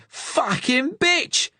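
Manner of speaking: very high, drawn-out slow scream. A narrator's reading. Gunman bitten on the hand by Sarah, slow high scream. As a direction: scream it out in pain. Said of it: "He screamed".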